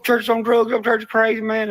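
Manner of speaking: high wound-up rant; excited rant. Real, not acted. Ranting about his church, high and excited. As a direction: push it up, amped.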